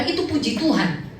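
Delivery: usual speaking voice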